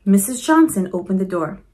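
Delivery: plain reading voice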